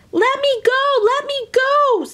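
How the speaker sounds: high, loud squeaky leprechaun cry; high leprechaun yelling to be let go; leprechaun shouting. Acted. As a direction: shriek it like a trapped little man. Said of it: "the leprechaun shouted".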